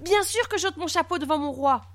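high, eager reassurance; greatly relieved. A narrator's reading. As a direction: rush to reassure the king in a high voice. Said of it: "grandement soulagé". (soulagé et empressé, voix aiguë)

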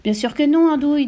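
high, loud exasperated retort; rebuffade agacée. A narrator's reading. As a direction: snap at your silly husband. Mother snaps at foolish husband. (réplique agacée, aiguë et forte)

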